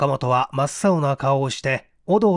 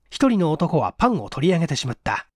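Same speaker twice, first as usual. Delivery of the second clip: usual speaking voice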